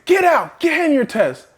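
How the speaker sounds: high, sharp teacher order; teacher scolding. Retold or imitated. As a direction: bark it like a teacher.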